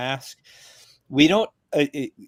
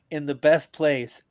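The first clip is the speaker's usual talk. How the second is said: normal talking voice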